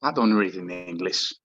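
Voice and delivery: put-on Greek father, heavy Greek accent. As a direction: speak English with a thick Greek accent. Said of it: "speaks with a heavy Greek accent".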